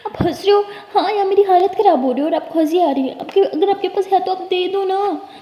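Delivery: stung that she laughs at her; girl protesting to the laughing older sister; upset protest. Acted.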